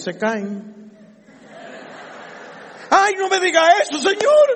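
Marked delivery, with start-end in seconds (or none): 2.9-4.5 high, loud pleading whine; mock scared protest. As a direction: play a startled believer begging him to stop. Mocking a frightened listener.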